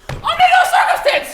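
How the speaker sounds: very high, loud squeal. Acted. Pig two squeals at pig three not to open. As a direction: squeal a frantic refusal. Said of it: "squealed two".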